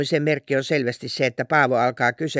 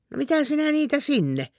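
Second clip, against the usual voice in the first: surprised; 11 semitones higher than usual. Acted. Salme finds the misplaced things.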